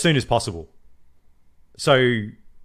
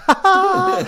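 high, laughing mock triumph; gleeful laugh; 6 dB louder than usual. Elon as Thanos gloating, high laughing mock triumph. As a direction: cackle like a gleeful villain. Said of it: "He's like".

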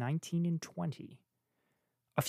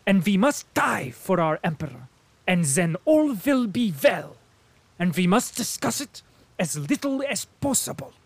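fervent German colonel; 6 semitones higher, 7 dB louder than usual. A narrator's reading. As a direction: declare it while thumping the table. Colonel continues.

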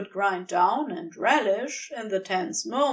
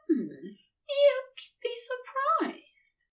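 whispering; 13 semitones higher, much slower than usual. Acted. Lover's teasing murmur.

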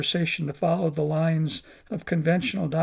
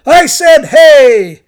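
a much louder, higher shout; yelling louder; 8 semitones higher, 19 dB louder, much slower than usual. Acted. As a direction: bellow it so nobody can ignore it. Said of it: "he yelled louder".

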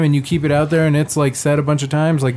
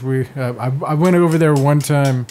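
usual speaking voice

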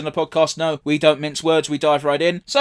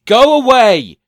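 loud shout, higher; 6 semitones higher, 9 dB louder, much slower than usual. Retold or imitated. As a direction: yell it out to get rid of him. Shouted quote at screen.

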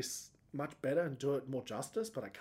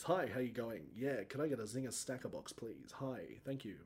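lower, softer polite counter voice; ordering food voice; 3 semitones lower, 6 dB quieter than usual. Shown as a demonstration. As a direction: order at the drive-through politely and calmly. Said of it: "It's my ordering food voice".

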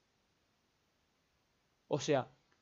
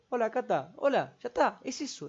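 higher, quick hello at the door; lightly acted greeting to a friend; cheery greeting; 5 semitones higher than usual. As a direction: pop in and chirp hello. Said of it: "me paro en la puerta y digo".

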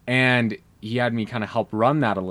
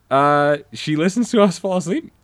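surprised; 7 semitones higher, 6 dB louder than usual. Retold or imitated. DM voiced, surprised question.

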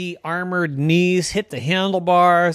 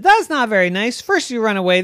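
higher and a touch louder, indignant; hurt scolding; 7 semitones higher, 5 dB louder than usual. Acted. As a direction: scold the horse for laughing. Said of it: "And Wanda said".

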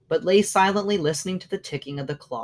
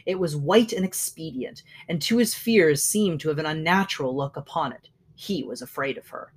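ordinary delivery, nothing marked

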